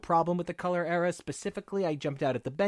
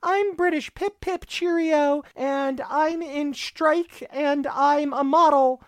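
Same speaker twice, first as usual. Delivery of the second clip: much higher, hammed-up mock British Betsy, slow at the end; 8 semitones higher, 3 dB louder, much slower than usual. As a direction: tease her as a cheerio-saying model. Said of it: "I'm not gonna do the accent".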